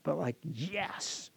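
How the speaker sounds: a full, wholehearted yes; hearty yes. Shown as a demonstration. Pastor modelling the congregation's response, hearty. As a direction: answer yes with real conviction. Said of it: "But I mean like a hearty yes".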